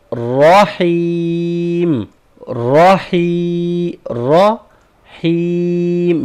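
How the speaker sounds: رحیم chanted very slowly with a long falling ending; tajweed ending demo; drawn recitation. Shown as a demonstration. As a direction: stretch رحیم and let it fall. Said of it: "اس کو ہم نے اینڈ میں جو ہے".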